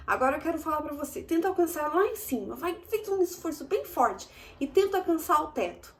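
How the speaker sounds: high, fast cheerful urging; bright squeaky sing-song welcome. Acted. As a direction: coax the kids to stretch up. Urging kids to reach up. (incentivo agudo e animado)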